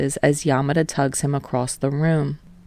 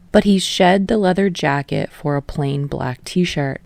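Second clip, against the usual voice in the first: ordinary delivery, nothing marked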